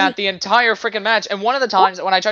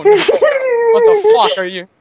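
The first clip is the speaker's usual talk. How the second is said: high, loud, slow baffled yell; shocked shout; 6 dB louder, slower than usual. Real, not acted. Player yells at a strange enemy, loud slow shock. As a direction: stretch it out in disbelief. Weird in-game sight.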